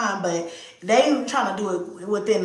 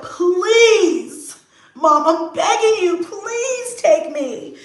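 put-on begging child; 9 semitones higher, 5 dB louder, much slower than usual. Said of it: "She's like".

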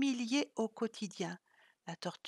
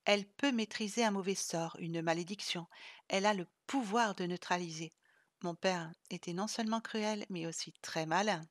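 plain reading voice

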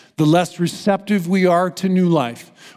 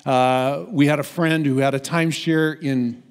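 normal talking voice

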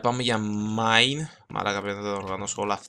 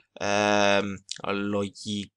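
ordinary talk

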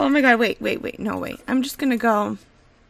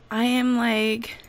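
usual speaking voice

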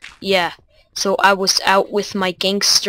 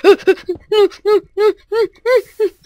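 startled whimper; 12 semitones higher, 4 dB louder than usual. Real, not acted. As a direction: yelp of fright. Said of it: "I just whimpered like a little boy".

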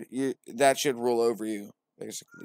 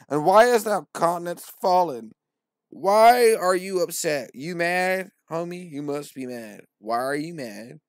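higher, louder mocking divine voice; Lord as a bro; 4 semitones higher, 9 dB louder than usual. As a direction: tease him like a pushy god.

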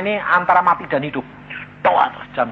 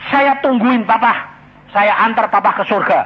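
shouting; 4 semitones higher, 8 dB louder than usual. Retold or imitated. Speaker shouts to his deaf, dying daughter. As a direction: shout it so a half-deaf ear hears. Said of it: "Saya teriak-teriak loh".

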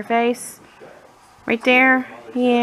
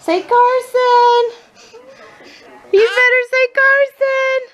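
high, slow coaxing singsong; 13 semitones higher, 5 dB louder than usual. Real, not acted. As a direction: coax him high and slow to say it. Coaxing toddler name.

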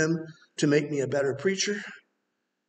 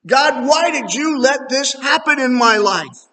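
plaintive cry to God; 6 semitones higher, 5 dB louder than usual. Retold or imitated. Grieving believer questions God.

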